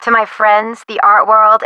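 usual speaking voice

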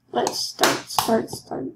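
urgent whispered repeat; whispering. Acted. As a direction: whisper it under your breath, impatient. Game loading, repeating to herself.

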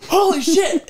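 high, loud shocked cry; shocked high outburst; retold shocked yell. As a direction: yell it in disbelief. Seeing bone sticking out.

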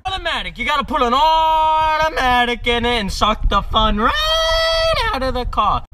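high-pitched shouted Jersey mockery; angry Jersey mobster. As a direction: bellow it like a fed-up mobster dad. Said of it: "my terrible Jersey Italian mobster, angry Wolf of Wall Street dad accent".

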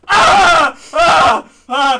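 high loud scream of fright; startled scream. Real, not acted. Vlogger thinks a spider is attacking him, screaming. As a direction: scream as if bitten.

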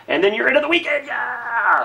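high, bright cheer; excited. Real, not acted. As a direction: celebrate the weekend. Cheering the weekend.

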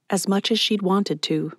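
plain reading voice

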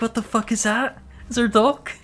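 retelling being startled by screaming boars; retold panic. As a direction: act out being spooked by strange screams. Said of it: "was like".

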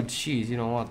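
usual speaking voice

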